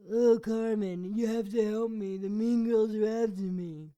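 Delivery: high, loud, whiny mock plea; mocking whiny imitation. Demon mocking Finney's plea to Carmen, whiny. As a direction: mimic a scared kid begging for help. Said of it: "I've been on your scent".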